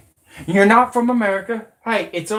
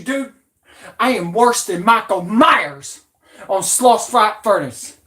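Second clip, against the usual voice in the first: ordinary delivery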